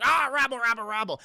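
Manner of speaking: hammed-up brawling chatter; mock crowd uproar. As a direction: imitate an angry mob arguing. Imitates whole team brawling.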